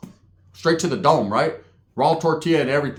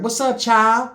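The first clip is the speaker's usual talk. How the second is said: high friendly greeting; 7 semitones higher, 3 dB louder, slower than usual. Retold or imitated. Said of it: "his old lady's like".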